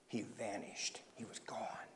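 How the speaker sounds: very soft storyteller's whisper; hushed whisper. A narrator's reading. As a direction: drop to a whisper for suspense. Narrator hushes as Larry sinks.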